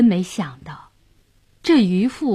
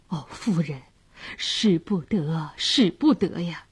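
anxious husband; 2 semitones lower, 4 dB quieter than usual. A narrator's reading. Husband urging his wife not to do it, worried. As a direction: gently beg her to hold back.

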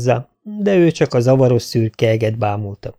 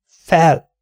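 higher, raised-voice order; urgent command; 6 semitones higher, 4 dB louder than usual. A narrator's reading. Dunk urges the fallen prince to rise, commanding. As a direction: call it out like ordering a horse up. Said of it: "mondta, miként mennydörgésnek is a viadal során".

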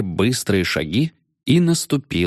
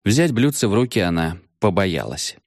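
ordinary delivery, nothing marked

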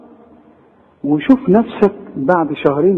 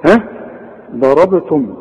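ordinary delivery

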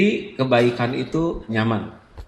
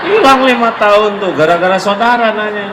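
loud, high outburst of dismay; exclaiming; 9 semitones higher, 10 dB louder than usual. Real, not acted. Preacher to congregation, loud high dismayed exclamation. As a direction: cry out the loss loud and high. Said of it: "preacher exclaims loss of reward".

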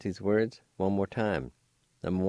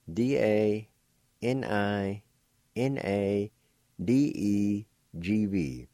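spelled out slowly; much slower than usual. Shown as a demonstration. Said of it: "It's spelled like this".

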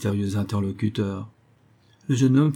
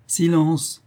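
sharp, high command of silence; 8 semitones higher, 4 dB louder than usual. A narrator's reading. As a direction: snap it out loud. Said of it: "reprit l'inconnu".

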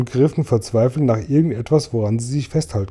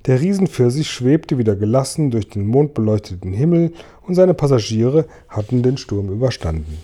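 ordinary delivery

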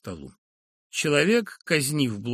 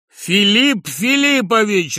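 slow, loud, higher exasperated cry; irritated exclamation; 8 semitones higher, 11 dB louder, much slower than usual. Acted. Professor snaps at the insolent lodger, loud and slow. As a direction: drag out the name in exasperation. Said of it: "раздражённо воскликнул".

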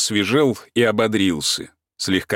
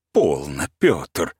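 lower, pompous exclamation; hammy stage actor; 7 semitones lower, much slower than usual. Acted. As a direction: declaim it like an old tragedian. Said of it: "с пафосом воскликнул".

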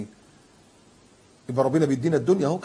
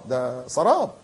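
ordinary talk, nothing marked

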